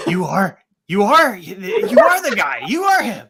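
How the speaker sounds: rising loud you are the guy; slow, building shout of recognition; excited realization. Retold or imitated. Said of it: "he was like".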